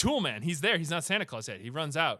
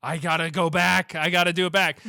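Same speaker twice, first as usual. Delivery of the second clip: louder, higher acted urgency; urgent resolve; 4 semitones higher, 9 dB louder than usual. Hero realizing things are bad, loud mock-urgent cry. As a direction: blurt it out in hammed-up alarm. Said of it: "Tim Allen's like".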